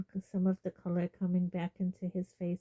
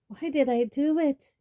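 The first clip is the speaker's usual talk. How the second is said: high, loud, frantic cry; panicked outburst of regret; wild panic; 6 semitones higher, 5 dB louder than usual. Acted. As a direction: blurt it out in horror. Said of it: "he said wildly".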